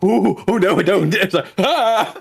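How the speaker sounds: high mock alarm; mock startled. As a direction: flinch back in mock fright. Acting startled pulling back.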